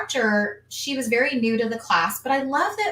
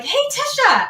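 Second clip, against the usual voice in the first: cheery instructor check-in; 11 semitones higher, 4 dB louder than usual. Retold or imitated. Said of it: "she's like".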